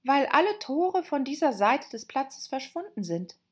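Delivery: Alice reasoning to herself; girl voice. A narrator's reading. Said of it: "dachte Alice".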